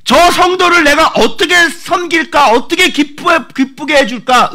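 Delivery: high, loud, slow scheming tone; eager plotting voice. Acted. A believer scheming to serve a fellow saint, high and slow.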